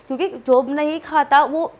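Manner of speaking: ordinary talk, nothing marked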